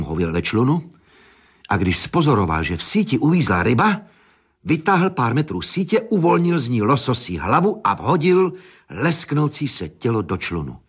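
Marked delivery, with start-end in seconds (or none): none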